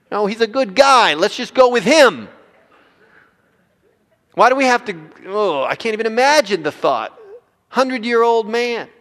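high, loud whiny bargaining; pleading Abraham. Acted. Abraham pleading with God, high loud whine. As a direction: beg God to take the easy route. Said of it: "God, can't we kinda just".

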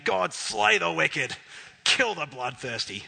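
loud and slow, pleading for judgment; forceful declaration. Retold or imitated. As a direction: call down judgment, hard and slow. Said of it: "to saying".

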